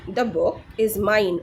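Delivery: very slow, separated words; deliberate teacher's example reading; repeat-after-me model. Shown as a demonstration. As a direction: model it slowly for learners to copy. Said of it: "আমার সাথে repeat করুন".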